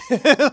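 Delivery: sudden loud laugh; loud burst of laughter. Real, not acted. Loud laugh at own joke.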